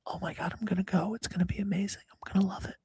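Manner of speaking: whispered mock-excited fake enthusiasm; giggly prank whisper. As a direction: whisper the fake cheerful line fast. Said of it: "I'm whispering into the mic".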